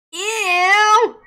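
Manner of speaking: loud, high drawn-out mock disgust; hammed-up revulsion, stretched long; grossed out. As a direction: squeal it like a disgusted kid. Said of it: "I was, like, grossed out".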